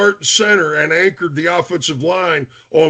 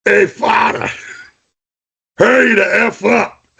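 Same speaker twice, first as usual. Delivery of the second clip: retold shout; 6 semitones higher than usual. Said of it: "Krlav goes".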